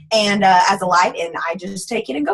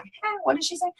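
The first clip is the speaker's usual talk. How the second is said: much softer, higher puzzled aside; small, high voice of confusion; baffled listener imitation; 7 semitones higher, 10 dB quieter than usual. Listeners puzzled by her thick accent, imitated softly and high.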